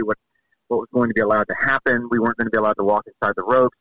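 ordinary talk, nothing marked

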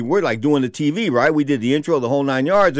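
usual speaking voice

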